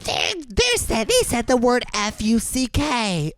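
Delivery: high, slow scandalized imitation; mock tattling. Offended man reporting the swearing to staff, mock tattling. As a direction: tattle in pretend horror, spelling it out.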